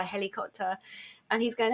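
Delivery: ordinary talk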